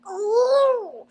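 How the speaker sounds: high, loud drawn out ooh of pain; imitated wince of pain; pained high groan. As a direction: groan up high as if it never ends. Enduring two and a half hours.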